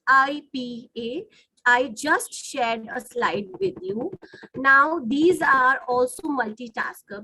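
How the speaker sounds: ordinary delivery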